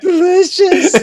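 very high mock-crying squeal; laughing imitation of brave sobbing; acting tough, tearfully. Boy walking off eating apple, mock-tearful bravado. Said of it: "Trying to act tough, eating the apple".

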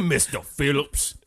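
slow, loud mock Southern introduction; bayou swamp man. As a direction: announce it in a drawn-out bayou drawl. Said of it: "the guy from the bayou".

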